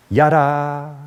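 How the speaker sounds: YADA with a long, held final AH; own pronunciation. Shown as a demonstration. Preacher showing the Hebrew word for knowing, drawn-out pronunciation. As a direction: say "yada" with the last syllable drawn out. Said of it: "that's how I pronounce it".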